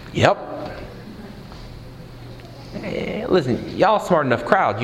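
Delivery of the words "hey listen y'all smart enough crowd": ordinary delivery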